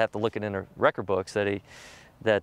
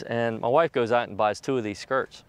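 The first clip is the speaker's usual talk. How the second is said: usual speaking voice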